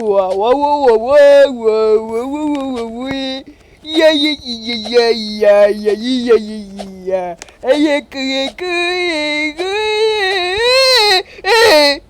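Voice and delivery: drawn-out wah-wah and ya-ya wails; mock wailing. Long wordless wailing noises. (ولولة تمثيلية بأصوات واه وياه)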